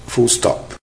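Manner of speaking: normal talking voice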